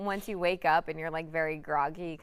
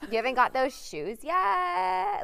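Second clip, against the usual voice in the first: high, slow, snotty demand; snotty teen tone; 10 semitones higher, slower than usual. Retold or imitated. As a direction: sneer it like a rude teen. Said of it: "I don't like the tone of voice".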